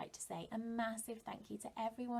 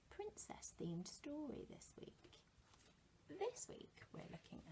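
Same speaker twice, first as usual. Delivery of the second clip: put-on hushed, mysterious whisper to children; secret whisper; 5 semitones higher, 10 dB quieter than usual. Teacher builds suspense for children.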